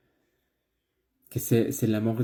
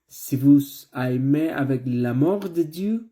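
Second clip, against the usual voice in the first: building preaching; 3 semitones higher, 4 dB louder than usual. Acted. Preaching builds toward the close.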